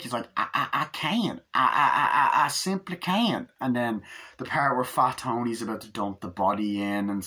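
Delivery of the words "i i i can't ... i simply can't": high, halting stammer in character; Cletus. Retold or imitated. Man trying to dump a rat at the lake, high stammer. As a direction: stammer helplessly in a put-on voice. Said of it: "Cletus goes up trying to".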